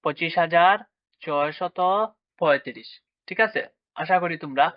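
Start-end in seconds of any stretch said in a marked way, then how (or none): 0.0-2.9 হাজার rising, then a pause before ছয়শত and before পঁয়ত্রিশ; number read-out demo. Shown as a demonstration. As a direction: teach it in parts: পঁচিশ হাজার, ছয়শত, পঁয়ত্রিশ. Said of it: "তাহলে উচ্চারণ কি হলো?".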